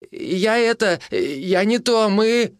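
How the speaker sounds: high, halting excuse; flustered stammer. A narrator's reading. Young man caught by the husband, flustered. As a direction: stammer helplessly with pitch way up.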